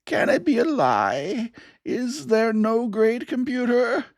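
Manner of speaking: put-on a screaming old preacher. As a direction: cry it out in disbelief, slow and high.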